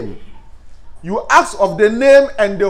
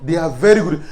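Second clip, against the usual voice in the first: soft flattering compliment; 7 semitones lower, 5 dB quieter than usual. Shown as a demonstration. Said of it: "You say".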